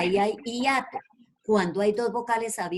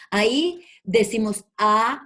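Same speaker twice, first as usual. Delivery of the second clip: ordinary talk, nothing marked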